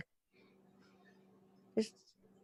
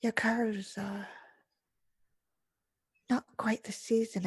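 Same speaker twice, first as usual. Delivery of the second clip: hushed, higher, halting insinuation; disused whispery voice; 4 semitones higher than usual. Acted. Veiled woman judging the player's body, whispering. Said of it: "whispers in a voice that feels like it had not been used".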